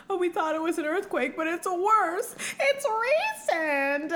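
high, hammed-up alarm, stretched at the end; mock panicked crowd. Wedding guests realising who arrived, mock panic. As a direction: squeal the news in pretend horror. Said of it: "Everyone's like".

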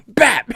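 loud high "Bap!"; mock smack. Hermione smacks Harry in the library, loud mock slap sound. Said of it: "she goes".

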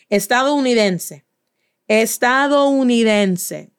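stretched-out, syllable-by-syllable Estadounidense; drawn-out teacher's demonstration of Estadounidense; slow model pronunciation. Shown as a demonstration. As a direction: say Estadounidense slowly, savouring every vowel. Teacher models word slowly for students.

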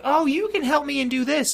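put-on high-pitched little girl voice. Little girl handing the player a quest, high put-on child voice. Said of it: "like, little girl".

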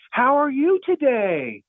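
slow, high-pitched humouring voice; over-friendly greeting. Acted. Store staff asking after Rusty, slow and over-friendly. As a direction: draw it out high, as if humouring him.

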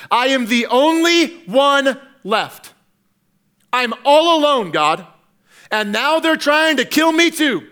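high, insistent protest; Elijah's complaint. Acted. Acting out Elijah's complaint to God.

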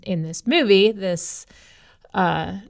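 ordinary talk

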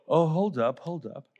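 low-pitched mock double take; taken aback. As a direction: act out disciples stopping short, voice dropped. Disciples amazed by calmed storm.

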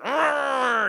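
loud, high mock roar of anger; frustrated comic-book roar; mad growling yell. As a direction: let out a drawn-out, hammed-up "arr" of rage. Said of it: "he gets all mad".

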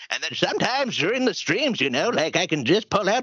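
put-on comic old man character. Shown as a demonstration. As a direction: slip into a creaky old codger. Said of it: "pull out the old man voice".

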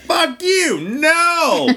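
high, mock-furious shouted no; defiant refusal. Braver present self refusing someone, loud and defiant. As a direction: yell the refusal in exaggerated defiance. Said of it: "Now I'm like".

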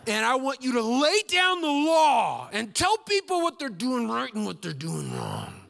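hammed-up fire-and-brimstone; mock fiery preacher. Churchgoer asking for a fiery preacher, mock loud preaching. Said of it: "what they really mean is".

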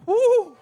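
sudden high whoop; joyful whoop. Real, not acted. Preacher whoops to the congregation, a high exultant cry. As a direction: let out a preacher's shout of joy. Preacher exclaims mid-sermon.

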